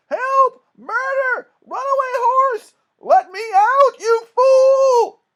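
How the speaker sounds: very high, very loud drawn-out screams for help; terrified screaming. A narrator's reading. Minister on a runaway horse cart, screaming in terror. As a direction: scream in panic, stretching the words. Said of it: "and he was screaming".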